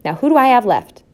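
high, loud mother's question; calling out. A narrator's reading. Mother checks who is left, calling out. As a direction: call it out to the whole brood. Mother continues roll call.

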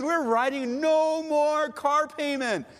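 high, slow, drawn-out celebration; jubilant declaration. Acted. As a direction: stretch it out like a triumphant announcement. Debtor celebrating the last car payment.

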